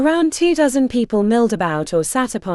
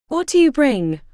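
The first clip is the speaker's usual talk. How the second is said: put-on old woman's thick Portuguese accent, a touch higher; 7 semitones higher than usual. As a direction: ask it with a heavy Portuguese accent. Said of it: "asked the old woman in a thick accent".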